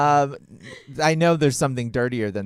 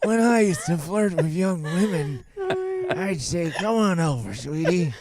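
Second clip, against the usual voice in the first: put-on old man voice; 3 semitones higher than usual. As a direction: play a dirty old man. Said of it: "That's my old man voice".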